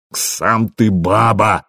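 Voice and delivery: slow, drawn-out angry cry; furious retort. A narrator's reading. Rasmus throws the insult back at Buri, slow furious retort. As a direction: stretch the insult out in mild fury. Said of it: "закричал Расмус яростно".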